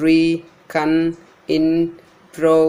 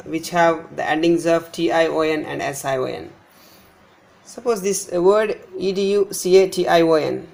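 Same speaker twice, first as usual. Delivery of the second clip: normal talking voice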